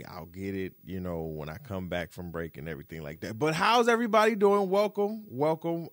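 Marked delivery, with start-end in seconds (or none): 3.4-5.9 hype host greeting. Real, not acted.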